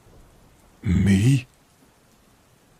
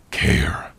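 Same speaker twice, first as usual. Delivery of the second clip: whispered; 7 semitones lower than usual. Acted. Orc father whispering to his partner about the child. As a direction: breathe the word out gently as a private aside. Tender aside to Tiny.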